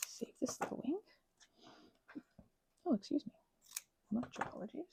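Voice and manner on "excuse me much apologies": usual speaking voice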